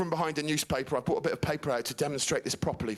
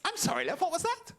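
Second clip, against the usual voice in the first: higher mock mum voice; playing the puzzled mother; mum asking; 13 semitones higher, faster than usual. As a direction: innocent mum, a pitch up. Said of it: "She went".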